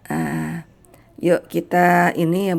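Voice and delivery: ordinary talk, nothing marked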